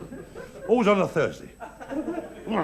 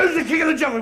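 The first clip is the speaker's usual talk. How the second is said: high-pitched bullying challenge; angry lion demand; 8 semitones higher than usual. Acted. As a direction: demand it of a bigger opponent. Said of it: "He was really mad".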